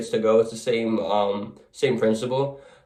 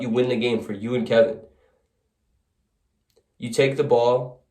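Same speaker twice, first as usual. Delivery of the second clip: plain reading voice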